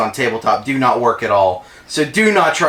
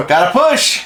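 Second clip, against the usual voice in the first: laughing rally cry, pitched up; laughing callout; 9 semitones higher, 3 dB louder than usual. Real, not acted. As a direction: shout it through a laugh. Laughing push callout in game.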